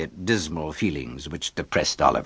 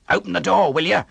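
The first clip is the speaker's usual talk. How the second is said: higher, calling voice through the door; crying out; 8 semitones higher, faster than usual. A narrator's reading. Boy kicking the shop door calls to Oliver, demanding. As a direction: call out impatiently to be let in. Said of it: "cried the voice".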